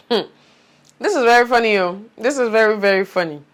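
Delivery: amused, laughing through the words; half-laughing, tickled by the story. Real, not acted. Laughing at a funny memory.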